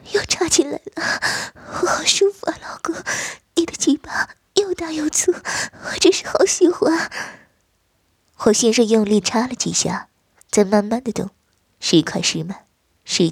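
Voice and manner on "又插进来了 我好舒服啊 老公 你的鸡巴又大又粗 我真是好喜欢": the wife, breathless and whispering in pleasure; a high, airy, whispered woman's voice; breathy wife. Acted. Wife's breathless moaning lines.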